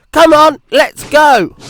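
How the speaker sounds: high, loud, slow eager cry; rally yourself to go. Acted. Percy eager.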